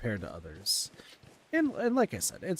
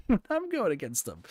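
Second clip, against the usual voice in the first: exasperated disbelief; 14 semitones higher, 5 dB louder than usual. Retold or imitated. His own exasperated reaction.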